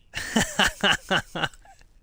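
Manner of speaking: loud, high belly laugh; real burst of loud cackling laughter; big laugh. Real, not acted. Player who took a friend's tractor, loud high laugh.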